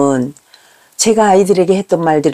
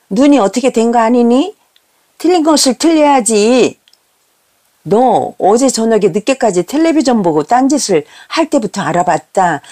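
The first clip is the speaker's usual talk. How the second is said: snapping reproach; 3 semitones higher than usual. Retold or imitated. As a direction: reproach the child point by point. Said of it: "아이에게 쏘아붙이는 말".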